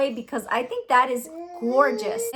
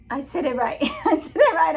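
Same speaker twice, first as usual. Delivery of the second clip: giggly, rising higher on the repeat; laughing with relief; 3 semitones higher than usual. Real, not acted. Vlogger finally getting the name right, laughing. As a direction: break into a laugh as you say it.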